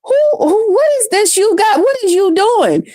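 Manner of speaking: put-on high, eager little-girl voice quoting her niece; niece's voice. A story to viewers, playing her curious niece in a high voice. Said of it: "she was like".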